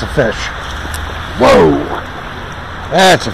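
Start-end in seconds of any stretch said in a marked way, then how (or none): none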